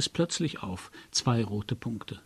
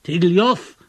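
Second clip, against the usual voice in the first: louder, higher call; calling again; 7 semitones higher, 9 dB louder than usual. A narrator's reading. Narrator repeats his call into silent fog. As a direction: call his name once more into the void. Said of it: "wiederholte ich".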